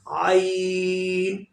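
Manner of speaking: slow "आइन" from the middle of the throat; middle-throat ain. Shown as a demonstration. Teacher models the throat letter for students, slow. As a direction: push "आइन" from mid-throat. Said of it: "pronounce आइन by middle throat".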